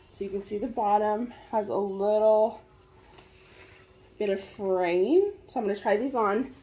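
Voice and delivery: normal talking voice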